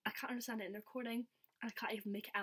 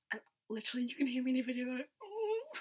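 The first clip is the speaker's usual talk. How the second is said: choked up; 3 semitones higher, 8 dB louder than usual. Real, not acted. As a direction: voice cracking into tears, higher and louder. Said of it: "'cause I'm that sad".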